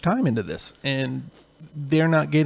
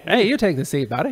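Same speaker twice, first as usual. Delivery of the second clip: mock Canadian politeness; 3 semitones higher than usual. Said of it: "a Canadian standoff".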